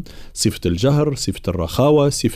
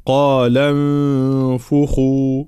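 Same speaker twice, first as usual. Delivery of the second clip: slow, drawn-out tajweed chant; Quran recitation; much slower than usual. Shown as a demonstration. Teacher reciting the next verse, slow tajweed recitation. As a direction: recite slowly, holding nasal and vowels.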